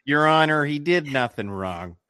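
loud opening, badly faked Midwestern accent; attempted lawyer's accent. Pleading to the judge, badly faked Midwestern accent. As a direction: try a lawyer's Midwestern plea, half-failing. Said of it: "See, I can't even do it".

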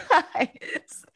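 drawn-out words dissolving into a laugh; breaking into laughter. Retold or imitated. Co-host retelling the coach's veiled threat, laughing. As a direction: quote it, cracking up on the last words.

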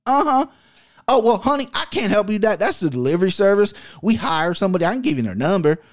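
chirpy country phone lady brushing him off, honey. Retold or imitated. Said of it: "After everything I say".

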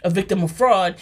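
ordinary talk

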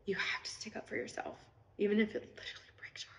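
whispered, picking up speed. Real, not acted. Vlogger tells her followers the hard lesson, whispering. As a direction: whisper it like it still hurts.

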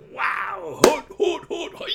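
high, loud playful hoots; hooting cheer. Real, not acted. Host cheering that football season is coming, loud high hoots. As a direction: hoot like an excited owl.

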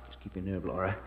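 low, soft conspiratorial aside; hushed aside. Acted. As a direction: murmur it so John can't hear.

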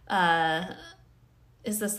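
normal talking voice

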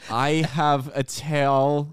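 very slow, drawn-out Malkovich voice; John Malkovich impression. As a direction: stretch every word like Malkovich. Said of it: "it's like John Malkovich".

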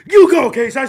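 high, loud mock cheer; imitated cheering. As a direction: shout encouragement like an excited supporter. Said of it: "She was like".

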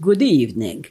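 lightly voiced Hungarian Dracula accent, low; a hint of Count Dracula's Hungarian accent on good evening. Shown as a demonstration. Child costumed as Dracula at the door, mild Hungarian accent. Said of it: "produced it with a strong Hungarian accent".

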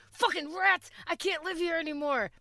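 high, exasperated outburst about rats; hammed-up city dweller losing it; mock freakout. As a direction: throw up your hands at the rats. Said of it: "I'm like".

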